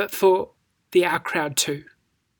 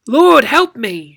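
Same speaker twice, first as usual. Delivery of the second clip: slow, louder begging plea; pleading; 4 semitones higher, 7 dB louder, slower than usual. Shown as a demonstration. The kneeling woman begging Jesus, slow pleading. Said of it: "with a little bit of inflection".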